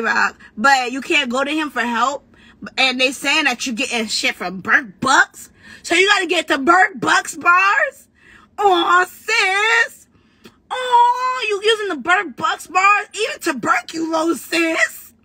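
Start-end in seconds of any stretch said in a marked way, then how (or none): 5.8-15.1 whiny fake crying, pitched way up; mock-sob at her like she's pathetic; hammed-up crying shout of pity. Mocking a rival with fake pity.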